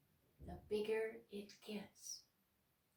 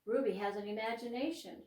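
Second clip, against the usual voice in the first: ordinary delivery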